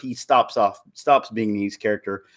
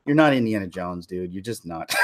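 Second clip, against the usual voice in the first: much softer, let-down dismissal; disappointed fan; 4 semitones lower, 7 dB quieter than usual. Retold or imitated. Fan telling the hero he's no Indiana Jones, deflated. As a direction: sigh it out as a fed-up fan. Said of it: "you're like".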